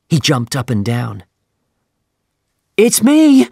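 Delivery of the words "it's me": very high, loud cry; boy shouting from the deck. Acted. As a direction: call out wildly to be noticed. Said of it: "he cried".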